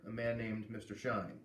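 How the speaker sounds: usual speaking voice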